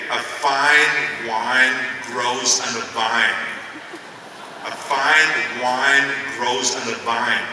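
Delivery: very slow, drawn-out pronunciation exercise; laboured speech-class recitation, word by word; elocution drill. Retold or imitated. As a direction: stretch out each word like practising diction. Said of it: "the kind of things that they say over and over again".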